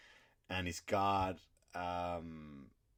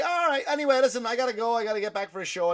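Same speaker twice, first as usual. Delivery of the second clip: flustered getaway; 13 semitones higher, 8 dB louder, faster than usual. Retold or imitated. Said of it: "So I was like".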